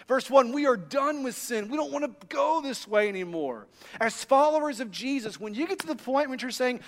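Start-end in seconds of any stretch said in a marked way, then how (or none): none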